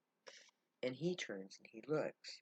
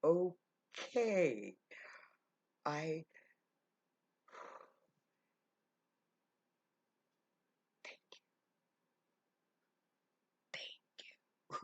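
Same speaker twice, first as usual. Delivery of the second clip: louder okay, then breaking into a whispered thank you; overwhelmed, voice giving way; choked up; 4 semitones higher, 4 dB louder, slower than usual. Retold or imitated. Old cobbler thanking Nathaniel, fighting back tears.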